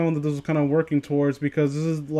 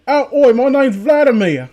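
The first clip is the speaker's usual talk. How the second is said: much higher, louder mock Cockney-Russian voice; terrible Russian-Cockney accent; 9 semitones higher, 8 dB louder than usual. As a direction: botch a Russian accent on purpose. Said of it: "worst Russian voice ever".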